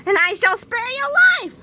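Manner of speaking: high, loud gloating threat; villain shout. Acted. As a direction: shout the offer triumphantly.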